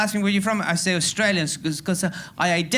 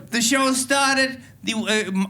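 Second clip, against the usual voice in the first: normal talking voice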